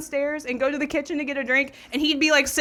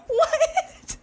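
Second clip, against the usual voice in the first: high laughing what; a stunned, laughing what; laughing surprise; 5 dB louder than usual. Retold or imitated. Hearing that Randy dumped her ex for her, high laughing surprise.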